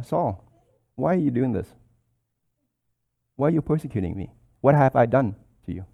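soft, tender plea; the Lord speaking gently to Saul; gentle humble king. Acted. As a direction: plead softly, like a grieved friend. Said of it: "I'm imagining the humble king".

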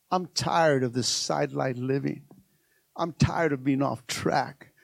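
low, soft acted complaint; quiet, weary resolve. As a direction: say it low, as if privately fed up. Quotes Bartimaeus fed up, low.

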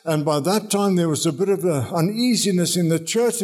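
ordinary talk, nothing marked

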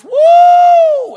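long, very high, piercing "woo"; getting-happy whoop. Shown as a demonstration. Demonstrating a worshipper getting happy in church, loud high whoop. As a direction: let out a sustained camp-meeting holler. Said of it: "it ends up something like this".

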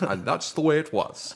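a hint of an old newsman sign-off; lightly voiced news anchor; Walter Cronkite impression. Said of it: "Like Walter Cronkite".

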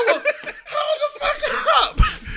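very high, slow, laughing; stunned disbelief. Retold or imitated. Reading scam video titles, slow high laughing disbelief. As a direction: draw it out in shocked laughter.

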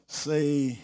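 usual speaking voice

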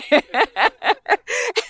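high, delighted laugh; amused laugh. Real, not acted. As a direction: laugh at Peter's eagerness. Laughs at Peter's zeal.

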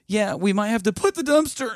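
high strained cracking voice; wrecked voice. Retold or imitated. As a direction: parody your own blown-out voice. Imitating himself with hoarse voice.

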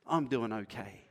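mildly dismissive mumbled reply; brushing it off. Retold or imitated. A churchgoer dodging the pastor's question, offhand and quiet. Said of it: "you can fumble that one away".

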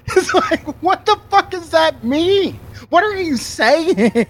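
incredulous. Real, not acted. Mocking the clip's weird claim.

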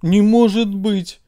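hammed-up disbelief; faked outrage. A narrator's reading. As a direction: brain pretending to be shocked. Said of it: "попытался изобразить возмущение мозг".

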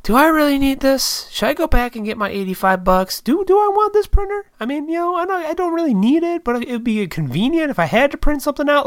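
high-pitched, agonized inner debate; questioning himself. Retold or imitated. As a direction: voice your second thoughts way up high. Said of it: "even that I've been questioning".

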